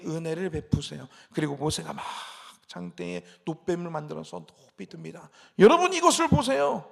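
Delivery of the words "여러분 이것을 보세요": raised, higher and louder proclamation; calling out. Retold or imitated. Moses lifting the bronze serpent to the people, calling out. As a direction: cry out to a whole crowd.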